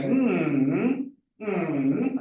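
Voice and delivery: wordless hum of a sentence melody, closed mouth; humming the tune. Shown as a demonstration. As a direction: hum the sentence contour as a demo. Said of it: "we had just a humming".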